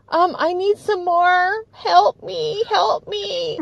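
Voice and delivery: high, whiny begging; mock needy plea. Imitating needy person returning.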